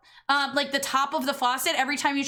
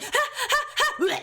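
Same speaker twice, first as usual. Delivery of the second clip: imitating dog panting; 10 semitones higher than usual. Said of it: "she'd be like".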